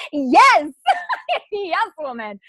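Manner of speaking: real delighted laughter, high and bubbling; giggly, delighted yes between laughs; laughing with joy. Real, not acted. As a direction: laugh it out, thrilled for her. Delighted laughter at a listener's booking.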